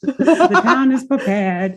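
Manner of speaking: put-on high, slow old-timey townsman. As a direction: proclaim it like a Puritan elder. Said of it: "he has an old-timey voice and everything".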